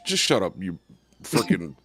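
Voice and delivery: ordinary talk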